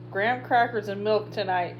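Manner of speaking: plain reading voice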